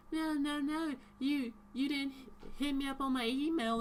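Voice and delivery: hammed-up teacher protesting; mock prim teacher. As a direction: whine it back like the teacher scolding. Teacher insists, voiced high.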